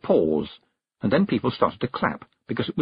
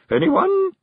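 very high, loud hopeful call; brittle brightness; 5 dB louder than usual. A narrator's reading. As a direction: sing out a desperately bright question. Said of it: "as brightly as a glass ball about to shatter".

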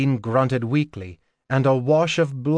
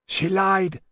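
raised, urgent man's voice; Dorian's voice; 7 semitones higher than usual. A narrator's reading. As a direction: speak up with urgency. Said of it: "Dorian's voice".

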